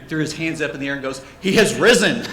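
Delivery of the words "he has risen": loud, raised hallelujah-style announcement; mock proclamation. Dad joking over customer's broken crucifix, mock proclamation.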